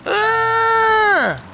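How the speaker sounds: long, high-pitched shout; sustained high yell; high scream. Real, not acted. Gamer yelling mid-race, long high scream.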